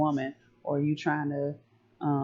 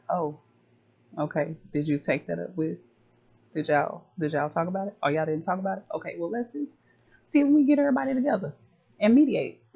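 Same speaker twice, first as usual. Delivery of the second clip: mama gathering everybody, getting more and more worked up; mama mediating; 2 semitones higher, 3 dB louder, faster than usual. Retold or imitated. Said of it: "mama gotta be like".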